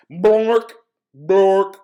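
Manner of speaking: silly, booming nonsense word; loud nonsense bark. Acted. Reader forced to say the book's nonsense words, loud and silly.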